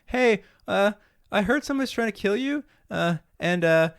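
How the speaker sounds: high, hesitant henchman impression; nervous toady. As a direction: stammer out a sheepish warning. Acting nervous toady warning his boss.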